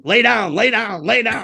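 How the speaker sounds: lightly acted inner warning; urgent inner voice. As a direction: repeat it like an inner command. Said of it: "where your brain's going".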